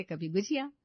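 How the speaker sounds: ordinary delivery, nothing marked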